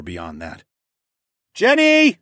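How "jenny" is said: much higher, loud call. A narrator's reading. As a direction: call her name, loud and high. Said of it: "I called".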